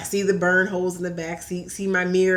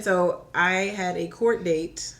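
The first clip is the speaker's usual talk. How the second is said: normal talking voice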